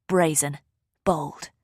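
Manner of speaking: usual speaking voice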